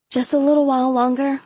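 high, pleading little request; understated, wheedling beg; begging. A narrator's reading. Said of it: "she begged".

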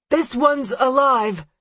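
high, slow officer shout. A narrator's reading. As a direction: call it out to others. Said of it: "one shouts".

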